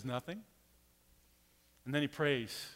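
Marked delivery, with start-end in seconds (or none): 0.0-0.4 softer, low, unbothered Nothing; calm quiet answer. Retold or imitated. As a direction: say it softly, totally unworried. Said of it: "Elisha says".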